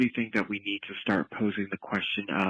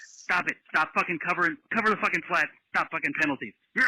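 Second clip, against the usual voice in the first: angry rant; 6 semitones higher, much faster than usual. Real, not acted. Caller ranting at the defense.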